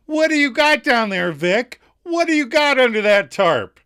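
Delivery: high, loud stage-actress delivery; [name]. Acted. Understudy performing at the window, loud theatrical call. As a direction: belt out a melodramatic question. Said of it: "[name] performs".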